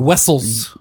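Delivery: mock Russian, W for V in "Wessels"; cartoon Russian pronunciation; Russian accent. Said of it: "Russian accent or Russian mixed with Groot".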